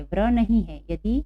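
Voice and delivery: usual speaking voice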